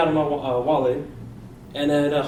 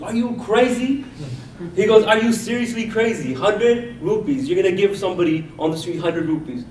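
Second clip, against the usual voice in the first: higher, mock-outraged "are you crazy?"; incredulous disbelief; 4 semitones higher than usual. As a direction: play the shocked cousin who can't believe it. Cousin shocked at big handout.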